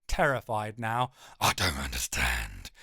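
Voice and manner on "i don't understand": very low whining dog voice; deep frightened whimpering beast; whining hound. Acted. Terrified giant hound whines at swordsman, deep and whiny. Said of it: "he whines".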